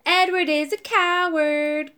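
slow, loud schoolyard jeer; sing-song taunt. A narrator's reading. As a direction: drag it out to mock him. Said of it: "cried Thomas".

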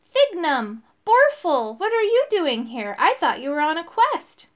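high, loud, astonished greeting; excited burst of recognition; crying out in surprise. A narrator's reading. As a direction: call each name out, amazed. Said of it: "cried Arthur".

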